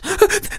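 high, sharp intake of breath; panicked gasp. Acted. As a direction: gasp in fright.

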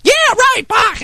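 high, eager outburst; sudden recognition. Acted. Harry is reminded of the composer by Steve, sudden excited recognition. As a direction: seize on the name the moment it comes back. Seizes on the forgotten composer's name.

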